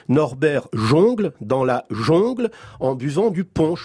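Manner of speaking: slow, every un said on: jongle, jungle, punch as jongle, jongle, ponche; on sound drill. Shown as a demonstration. Guest reads a tongue-twister to host, slow on sounds. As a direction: read the sentence slowly, jungle and punch with on. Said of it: "une petite phrase, euh, très amusante".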